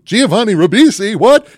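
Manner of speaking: shocked high yelp; surprised. Pretend. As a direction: blurt it out in disbelief. Imagined surprise visit.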